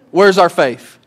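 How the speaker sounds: usual speaking voice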